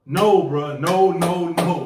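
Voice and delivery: loud, repeated comic no; emphatic refusal. Retold or imitated. Warning his coworker off the pickup, emphatic refusal. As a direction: shut it down loudly.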